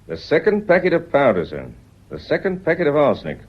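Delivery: spring the accusation on him; accusing. Acted. Inspector confronting the husband, sharply accusing.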